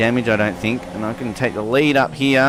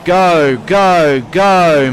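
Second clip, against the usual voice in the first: louder and higher with each number; excited countdown; 5 semitones higher, 6 dB louder, much slower than usual. Real, not acted. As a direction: hype the race start. Race start countdown.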